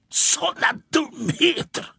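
high, slow, scoffing dismissal; a proud cat scoffing at nonsense; scornful snort. A narrator's reading. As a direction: sniff at the silly idea. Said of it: "fnyste Måns".

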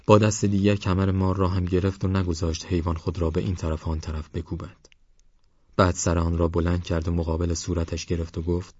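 usual speaking voice

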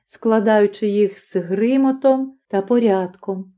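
usual speaking voice

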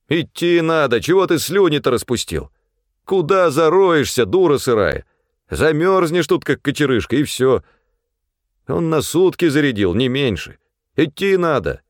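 high, forceful scolding; bully a quitter back onto his feet; scolding urgency. A narrator's reading. Pavel urging the slumped supply man to walk, scolding.

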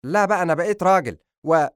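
loud, high defiant son; heated protest. Acted. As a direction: burst out against father until cut off. Son shouts back, gets cut off.

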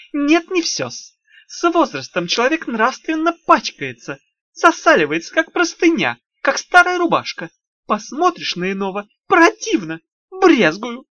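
high, wheedling little imp voice; sly, sugary demon lecturing; голос Тухламона. Acted. Тухламон продолжает поучать. (вкрадчивый писклявый голосок беса)

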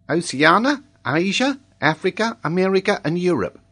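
put-on high child's voice, item by item; reciting schoolboy. A narrator's reading. Boy answers the professor's exam question, high recital. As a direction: list them like a drilled pupil.

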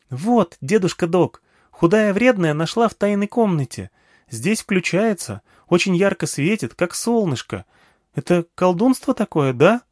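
put-on high, eager child voice; small girl eagerly addressing an old man; little girl. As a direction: pitch it up like a chatty little girl. Narrator voices little girl Nagma.

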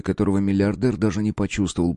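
usual speaking voice

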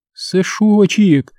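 high, drawn-out endearment; a touch of sobbing, stretched slowly; tearful sob. A narrator's reading. Mother weeping over her returned son, tearful endearment. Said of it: "всхлипнула мать".

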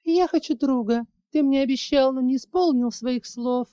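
put-on high, small child's voice pleading; a little dragon girl reminding her father of his promise; high childish voice, hurt; little girl babbling softly. A narrator's reading. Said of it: "Тихо пролепетала малышка".

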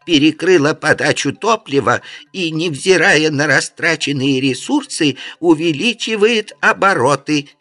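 even metallic machine voice; transforming robot. A narrator's reading. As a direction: explain like a calm robot. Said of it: "голос подробно пояснил".

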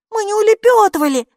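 loud, high, offended denial; indignant. A narrator's reading. Maiki denying they ran away, indignant. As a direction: protest loudly at being called a coward. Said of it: "возмутилась Майки".

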